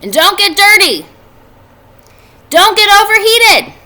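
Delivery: high, loud motherly nagging; fussing mother. A narrator's reading. Mother calling after her children, fussing loudly. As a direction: pile on the warnings. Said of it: "said their mother".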